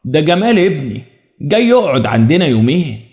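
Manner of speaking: ordinary delivery, nothing marked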